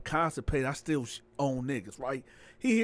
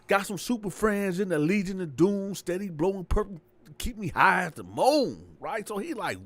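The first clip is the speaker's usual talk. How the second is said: ordinary talk, nothing marked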